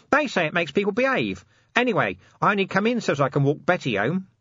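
high-pitched, chatty explanation; cheeky corporal justifying himself; Nobby, cheeky corporal. A narrator's reading. Nobby voiced in story.

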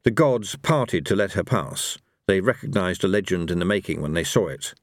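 ordinary delivery, nothing marked

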